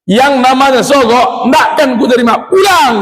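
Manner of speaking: shouted refusal, climbing high on pulang; fed up and shouting at the bribers; angry shout. Retold or imitated. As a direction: yell them out the door.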